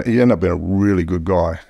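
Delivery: ordinary talk, nothing marked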